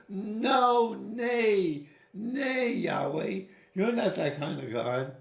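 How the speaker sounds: high, loud protest that breaks into a laugh; insistent Abraham. Retold or imitated. Abraham telling Yahweh he is not that kind of God, high and laughing. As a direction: refuse the idea with a laughing plea. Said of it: "And Abraham says".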